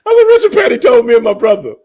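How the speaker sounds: high, loud, fast, through tears of laughter; can barely get it out laughing; crying with laughter; laughing hard. Real, not acted.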